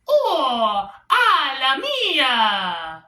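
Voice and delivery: high, slow Italian Mario impression; mock Super Mario exclamation. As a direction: belt it like a cartoon plumber. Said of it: "Sometimes I think he says".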